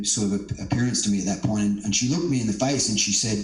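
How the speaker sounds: ordinary delivery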